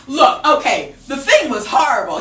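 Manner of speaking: normal talking voice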